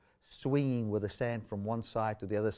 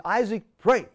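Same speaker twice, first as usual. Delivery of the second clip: raised, higher and louder urgent order; urgent command; 9 semitones higher, 9 dB louder than usual. Retold or imitated. Stressed driver ordering his passenger to pray, urgent command. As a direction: call it out to your companion in a crisis.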